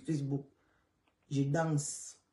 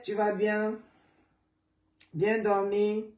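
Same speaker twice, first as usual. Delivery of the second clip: ordinary delivery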